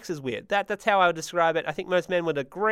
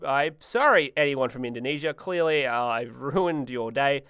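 ordinary talk

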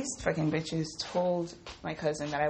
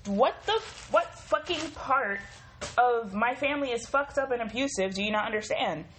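retold angry outburst; 6 semitones higher than usual. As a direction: let the anger burst out again as you retell it.

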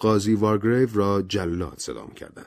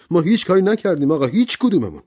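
higher, faster angry insistence; 10 semitones higher, 6 dB louder, faster than usual. Acted. Servant Rogers insisting to the judge, louder and angrier. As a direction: push harder, escalating. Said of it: "با عصبانیت گفت".

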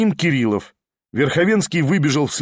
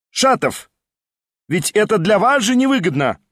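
higher cry with a hidden warning; mysterious call; 6 semitones higher than usual. A narrator's reading. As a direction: call after him as he leaves. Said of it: "загадочно крикнул ему вслед".

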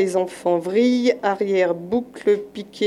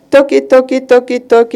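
loud knocking chant; 4 semitones higher, 7 dB louder than usual. Acted. Knocking at the monkey door.

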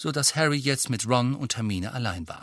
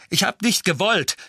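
stammering; 8 semitones higher, 7 dB louder than usual. Acted. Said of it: "stammelte Harry".

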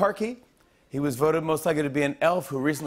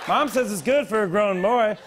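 put-on childish voice; 4 semitones higher than usual. Voicing milk-drinking boy.

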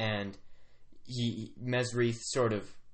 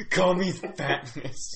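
louder, higher chief voice, drawn out; lightly grumbly chief, slow and raised; 5 semitones higher, 5 dB louder, much slower than usual. Acted. Chief telling visitor what to call him, lightly grumbly. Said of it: "some sort of weird grumbly accent".

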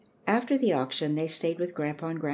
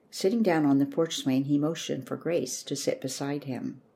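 plain reading voice

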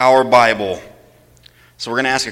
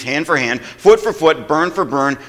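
usual speaking voice

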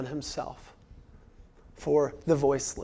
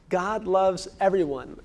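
usual speaking voice

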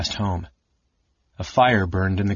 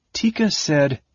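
higher-pitched, uneasy false start; nervous hesitation; 8 semitones higher than usual. A narrator's reading. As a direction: begin reluctantly and break off. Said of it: "Taz swallowed".

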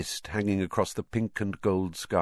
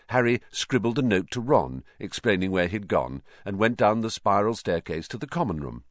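ordinary delivery

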